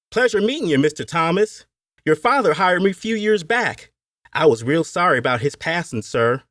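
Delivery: high-pitched Appalachian overseer; Appalachian accent. A narrator's reading. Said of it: "he said in his Appalachian accent".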